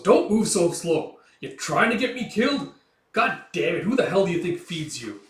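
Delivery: loud, high angry tirade; furious yelling. Acted. Gambino berating little Guts in battle, loud furious tirade. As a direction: tear into him.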